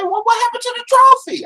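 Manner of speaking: very high-pitched mock question; imitating questioning son. Son takes off his glasses to question dad, high mock voice. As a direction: pitch it way up as a puzzled child.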